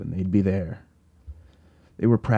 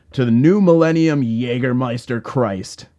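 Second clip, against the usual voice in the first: a touch louder, a mild mock yell; yelled toast; 4 semitones higher, 4 dB louder than usual. Narrator toasting at the bar with a mild mock yell. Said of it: "I yelled at the top of my lungs".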